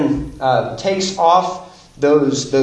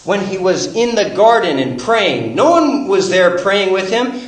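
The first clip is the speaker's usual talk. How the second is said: louder and higher, driving each line home; preaching at full force; 8 semitones higher, 5 dB louder than usual. Acted. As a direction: preach it like the climax of the sermon. Sermon rises again.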